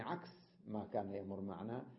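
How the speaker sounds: ordinary talk, nothing marked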